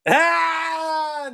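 mock anguished cry. Real, not acted. Reacting to the cohost's joke, mock pained cry. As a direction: yelp in mock horror.

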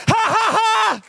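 high-pitched triumphant mock cackle; retold gleeful laugh. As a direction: cap the teasing with a high guffaw. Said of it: "laughing my brains out".

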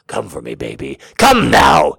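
low command building to a loud order. A narrator's reading. Man ordering his lover, commanding and building loud. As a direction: start low, then burst out forcefully. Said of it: "he commands".

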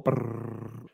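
rolled lips drum roll; mouth drum roll. Pretend. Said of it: "drum roll".

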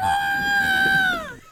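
very high, loud squealing laughter; screaming laugh. Real, not acted. Friend cracking up at a missing-vertebrae joke, squealing with laughter. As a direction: burst into a long piercing laugh.

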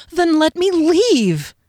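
high, loud, drawn-out cry; frightened, stretched-out wail; desperate plea. A narrator's reading. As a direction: beg to be let go. Captive begs to be released.

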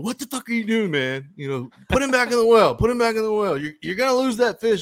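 high-pitched mock yell at his brother. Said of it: "he starts yelling".

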